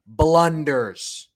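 ordinary talk, nothing marked

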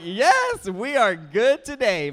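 loud, high, drawn-out cheer; big slow triumphant whoop of words; elated relief. Retold or imitated. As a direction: celebrate like a hugely relieved dad. Retelling relief at child waking happy.

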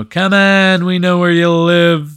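loud, high, drawn-out heckle; yelling. Retold or imitated. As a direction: holler it up at his apartment. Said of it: "just start yelling to Joe Gatto".

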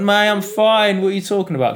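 high, loud insistence that he's fine; indignant protest. Retold or imitated. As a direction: brush off the worry, voice up and pushing. Concussed self protesting to teammate.

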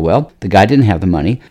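ordinary delivery

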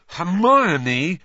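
loud, high, drawn-out suspicion; knowing, suspicious singling out; cottoning on. A narrator's reading. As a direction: say the name as a rising accusation. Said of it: "cottoning on".

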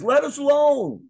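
high, loud mock cry of a demon; protesting unclean spirit. Demon protests on seeing Jesus.

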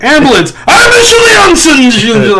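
high, drawn-out mock German shout; mock aggressive German. Comedian friend mocks the German word for ambulance, aggressive shout. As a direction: yell it like hammed-up aggressive German. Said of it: "sound, like, super aggressive all the time".

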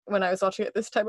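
ordinary delivery

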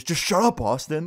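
retold irritation. Classmate telling his friend to back off, mock irritated. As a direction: imitate an annoyed kid, pitch jumping up.

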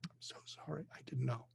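whispered apology, voice almost gone; choked whisper. Retold or imitated. Doctor whispering a choked apology to his patient. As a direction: breathe it out, barely voiced, overcome.

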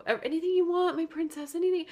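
high, gushing mock devotion; hammed-up high lovesick babbling; fawning puppy-dog suitor. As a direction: impersonate him fawning all over her, pitched well up. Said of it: "completely like a puppy dog".

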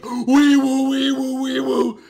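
very high, loud frantic wee woo; screaming panicked vocal siren. Retold or imitated. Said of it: "starts to panic and goes".

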